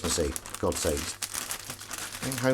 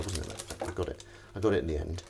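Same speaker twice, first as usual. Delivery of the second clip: normal talking voice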